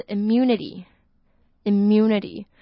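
immunity said slowly and clearly. Shown as a demonstration. Teacher models immunity for learners, slowly. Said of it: "一个人的免疫力".

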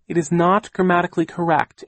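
high, loud pedantic correction; Aunt Josephine. A narrator's reading. Aunt correcting nephew's grammar, pedantic.

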